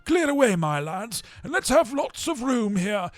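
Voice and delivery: put-on a fat merry master calling his boys; jovial Fezziwig. A narrator's reading. Fezziwig calls out.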